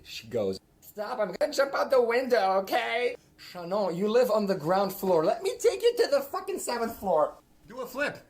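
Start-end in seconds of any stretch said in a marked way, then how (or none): 0.8-3.1 much higher, louder mock tantrum; mad shriek. Shannon storming off in class, a high, loud mock tantrum. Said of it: "She got so mad". 3.4-8.2 much higher, louder taunting; mocking rant. Real, not acted. Taunting Shannon about the ground floor, a high loud mocking yell.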